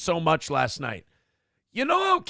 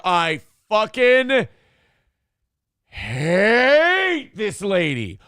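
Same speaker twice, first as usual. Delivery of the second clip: slow, loud, seething hate; angry; 3 semitones higher, 5 dB louder, much slower than usual. Real, not acted. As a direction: drag it out with contempt. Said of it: "get out a lot of hatred and a lot of anger".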